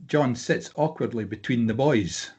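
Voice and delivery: normal talking voice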